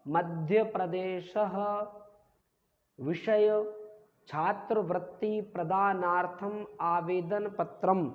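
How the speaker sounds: "मध्य प्रदेशः" drawn out to a final sha-ha; slow, word-by-word dictation of "छात्रवृत्ति प्रदानार्थम आवेदन पत्रम"; pronunciation demo. Shown as a demonstration. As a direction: read "विषय छात्रवृत्ति प्रदानार्थम" slowly and evenly. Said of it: "हा का उच्चारण".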